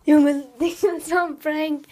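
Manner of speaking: loud laugh, slow; laughing shout. Real, not acted. Laughing loudly after prank.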